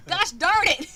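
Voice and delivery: loud, high comic frustration; exasperated outburst. Real, not acted. Game master whose accent slipped again, exasperated outburst. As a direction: curse it, fed up with yourself.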